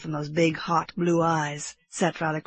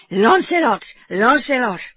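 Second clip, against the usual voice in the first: put-on higher, louder French-accented cheer; French accent yell; 7 semitones higher, 7 dB louder, slower than usual. A narrator's reading. Frenchman cheering his horse, French-accented yell. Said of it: "in his French accent".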